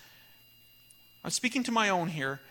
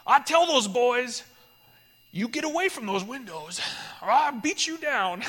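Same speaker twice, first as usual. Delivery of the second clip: tough neighbor Razor; 5 semitones higher, 4 dB louder than usual. Retold or imitated. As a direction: play the rough guy defending the cafe, louder. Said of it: "And Razor goes".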